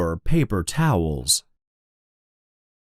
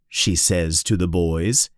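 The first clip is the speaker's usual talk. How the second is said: plain reading voice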